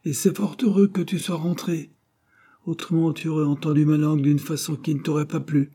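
high-pitched scolding threat; sharp retort. A narrator's reading. Wife threatening husband with her tongue, high scolding. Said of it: "répliqua madame Cartier".

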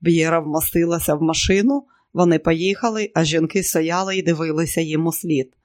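plain reading voice

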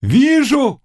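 high, loud cry of a seer; witch's cry. A narrator's reading. Witch announcing her vision to the Cossack, crying out. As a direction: burst out high as the vision appears. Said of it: "крикнула ведьма".

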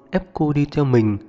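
plain reading voice

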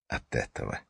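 "От этого" whispered, hushed and pointed; whispered repeat. A narrator's reading. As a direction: whisper "от этого" as a bitter aside.

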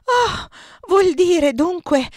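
breathless disbelief, voice shaking; trembling with emotion. A narrator's reading. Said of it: "tremavo in tutta la persona".

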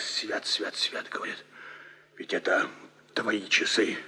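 awed whisper. Acted. Uncle whispers a charm on seeing the watch. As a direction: breathe it out in holy dread. Uncle crossing himself in awe.

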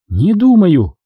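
flat refusal; defiant no; defiant retort. Acted. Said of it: "возразила Куара".

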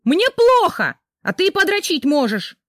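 high furious yell; wife yelling at husband, furious. Acted. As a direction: scream it at him in rage. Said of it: "заорала Рита".

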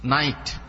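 নাইট read out slowly as the answer to the spelling. Shown as a demonstration. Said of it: "N I T ই কি হয়?". (বানানের উত্তরে নাইট উচ্চারণ)

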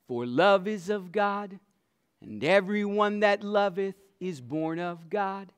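loud, ringing scripture proclamation; preaching at full force. Acted. Preacher reads First John to the congregation, loud and ringing. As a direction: proclaim it to the back row.

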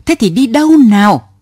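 a driver at the end of his rope, roaring. Acted. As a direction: bellow the question. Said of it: "Ông gầm lên".

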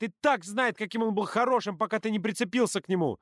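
high, indignant retort; heated quarrel. A narrator's reading. Shouted quarrel between rivals.

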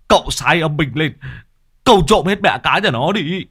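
high, loud aggrieved rant; Long ranting about the stingy pond owner; loud whining complaint. Acted. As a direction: bawl out your grievance. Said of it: "ngoạc mồm".

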